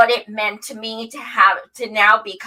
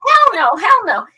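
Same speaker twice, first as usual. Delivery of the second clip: high, loud, fast protest; emphatic refusal; 12 semitones higher, 4 dB louder than usual. Retold or imitated. Telling Steve no on camera, loud high protest. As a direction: refuse him loudly, no way. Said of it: "And I was like".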